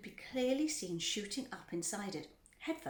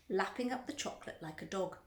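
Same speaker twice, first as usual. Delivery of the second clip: ordinary delivery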